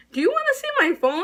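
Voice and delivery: high, fast, insistent offer; indignant protest. Retold or imitated. Accused student pressing the official to check the phone, indignant. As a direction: rush out the offer in a high, urgent voice.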